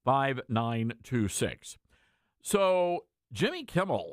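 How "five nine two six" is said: number read slowly and clearly; slow repeat. Shown as a demonstration. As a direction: spell out each digit slowly. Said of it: "our telephone number".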